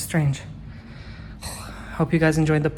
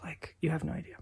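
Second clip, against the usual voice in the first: whisper; 10 dB quieter, faster than usual. Real, not acted. Quiet morning routine talk.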